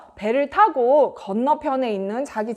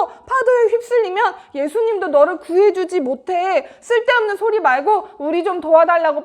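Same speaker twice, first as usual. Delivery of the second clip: acted scolding; 5 semitones higher than usual. Disciples scolding Peter for his silly idea, exasperated raised voice. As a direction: tell him off in alarm.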